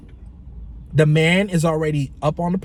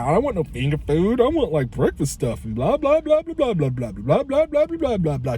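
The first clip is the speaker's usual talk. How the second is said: sing-song mocking whine, swooping up and down; mock whining complaint; 4 semitones higher than usual. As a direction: make his griping sound stupid, sliding into babble. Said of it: "he was gonna complain about that".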